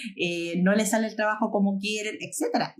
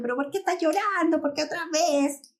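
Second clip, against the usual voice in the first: higher-pitched mock scolding; exasperated parent; 6 semitones higher than usual. Parent to a child who comes crying, mock exasperated. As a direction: imitate a parent fed up with crying.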